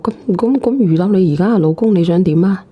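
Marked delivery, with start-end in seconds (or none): none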